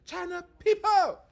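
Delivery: put-on loud, high Chinese-accented outburst; shouted imitation of a Chinese accent; angry Chinese accent. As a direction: yell it as an offended Chinese woman. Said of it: "she goes".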